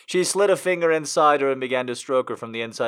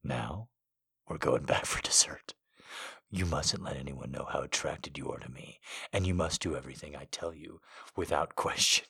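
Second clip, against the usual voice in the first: low, soft, seductive man; seductive whisper; 12 semitones lower, 13 dB quieter than usual. Acted. Seductive male voice.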